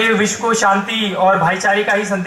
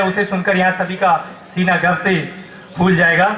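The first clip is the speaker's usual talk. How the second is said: ordinary talk, nothing marked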